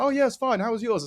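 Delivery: a light mock of a teammate shrugging it off; imitating teammates. Voicing the other lads' reply.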